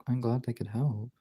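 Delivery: ordinary talk, nothing marked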